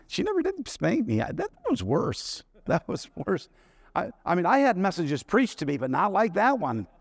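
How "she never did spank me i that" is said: normal talking voice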